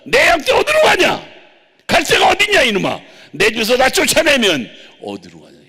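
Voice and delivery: very high, very loud shouting rebuke; the Lord scolding his servant, shouting. Retold or imitated. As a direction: roar it at the servant. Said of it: "야단치는".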